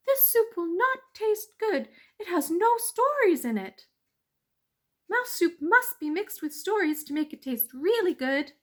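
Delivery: high, loud persuasive mouse; a small mouse talking his way out; clever mouse voice. Acted. Mouse persuading the weasel, high and eager.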